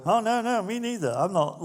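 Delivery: high, loud, quick "me too" protest; eager disciple joining in. Retold or imitated. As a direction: chime in fast so you're not left out. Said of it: "you kinda go".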